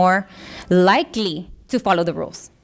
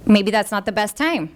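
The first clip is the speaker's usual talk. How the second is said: usual speaking voice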